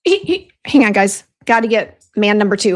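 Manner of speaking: loud and rushed; a loud hurried aside to the viewers; hurried call. Real, not acted. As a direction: call it out while hurrying off. Rushing off to get help.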